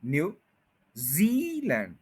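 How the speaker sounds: very slow New Zealand, जी on top; slow stressed model. Shown as a demonstration. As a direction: land hard on जी. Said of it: "जी पे पूरा stress करें आप".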